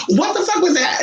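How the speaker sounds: high-pitched, hammed-up shock; dramatic alarm. Cousin startled by a voice in her ear, very dramatic. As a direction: imitate a drama queen's startled cry. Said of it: "She's very dramatic".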